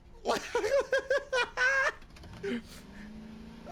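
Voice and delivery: squealing real laughter; high giggle fit. Real, not acted. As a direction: lose it laughing again. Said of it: "Sorry, I'm still laughing".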